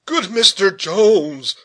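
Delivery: high, slow, loud clerical plea; drawn-out, alarmed clergyman's entreaty; worried clergyman. A narrator's reading. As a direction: appeal anxiously as a fretful parson. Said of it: "said the clergyman".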